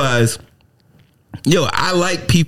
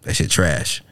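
flat dismissive verdict; 10 semitones lower, 5 dB quieter, slower than usual. Retold or imitated.

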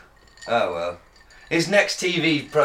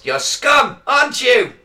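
mock berating shout; 9 semitones higher, 6 dB louder than usual. Mimicking Jeremy Kyle berating.

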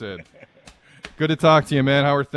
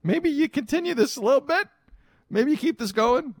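timid, much higher suggestion; sheepish. Retold or imitated. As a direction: ask meekly, hoping for a yes. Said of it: "even sheepishly".

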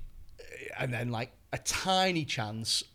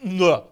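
punch grunt; 3 semitones higher, 13 dB louder than usual. Retold or imitated. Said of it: "that sort of punch".

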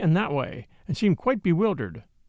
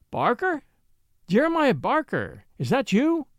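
higher-pitched, questioning lady's voice; surprised recognition; 6 semitones higher than usual. A narrator's reading. Lady recognising a cabman she knows, surprised.

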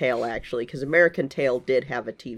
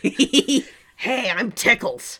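higher, giggly cartoon rat; laughing rat voice; 9 semitones higher, 4 dB louder, much slower than usual. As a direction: play a goofy rat through laughter. Voicing rat character, laughing.

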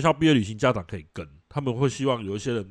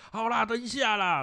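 imitating a stalling kid; 11 semitones higher than usual. Child stalling when called.

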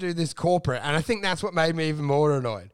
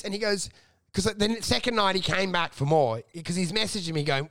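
usual speaking voice

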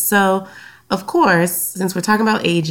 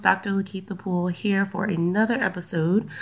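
ordinary talk

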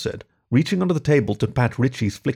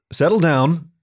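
firm, a bit louder settle down; sharply; 6 semitones higher, 4 dB louder, slower than usual. Acted. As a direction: Settle down pushed higher and harder, like a warning. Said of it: "spoke a little more sharply".

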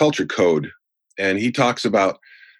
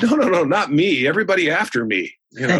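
playful protest; 9 semitones higher than usual. Retold or imitated. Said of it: "And I said".

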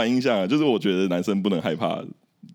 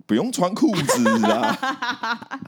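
high, very slow laughing line; giggling over the words; laughing blurt; 12 semitones higher, much slower than usual. Retold or imitated. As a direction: drag it out through a laugh. Blurted joke while laughing.

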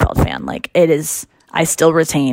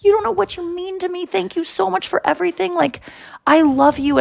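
starstruck gratitude; 12 semitones higher than usual. Retold or imitated. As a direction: pour out thanks to a hero. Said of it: "I was like".

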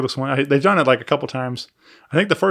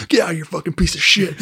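higher-pitched mock megaphone heckle; imitating megaphone taunt; 4 semitones higher than usual. Teasing a bandmate who needs no megaphone, mock megaphone heckle. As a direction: tease by mimicking the heckle. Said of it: "if we give you a megaphone today".

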